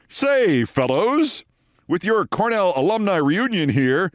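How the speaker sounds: high, hearty clubby voice; chummy old-boy greeting. Retold or imitated. Scott joins the comedians, in a high chummy old-boy voice. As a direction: play the three-piece-suit fellow. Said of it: "So Scott comes over and says".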